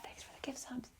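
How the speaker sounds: whispered quick thank-you. Real, not acted. Whispering thanks to a gifting viewer during the hunt. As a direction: whisper thanks so as not to disturb.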